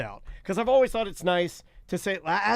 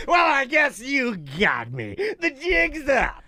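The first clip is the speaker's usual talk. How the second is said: mock confession; 5 semitones higher, much slower than usual. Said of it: "I'm like".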